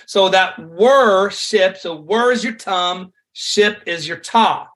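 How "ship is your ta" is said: normal talking voice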